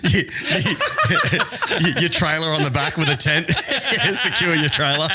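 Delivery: talking through real laughter; laughing. Real, not acted. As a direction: crack up while getting the joke out. Cracking up while joking.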